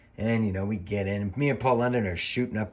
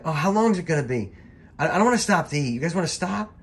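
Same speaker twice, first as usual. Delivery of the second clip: higher, louder mock whining; whiny complaint; 8 semitones higher, 4 dB louder, faster than usual. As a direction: play the sulky kid in the car. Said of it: "He's bitching".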